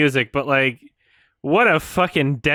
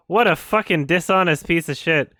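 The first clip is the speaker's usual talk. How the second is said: normal talking voice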